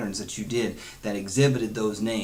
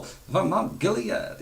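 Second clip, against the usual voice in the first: much higher and slow, with a little twist; Elaine's boss impression; 9 semitones higher, slower than usual. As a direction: drawl it out like the Seinfeld boss. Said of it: "He's got that little, twist there".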